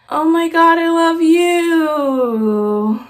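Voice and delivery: very slow, dragged-out, bored echo; mock his lazy, uninterested reply; flat imitation. Shane answering his fan, mock bored and slow. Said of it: "he said it just like that".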